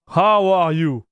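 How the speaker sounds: ordinary talk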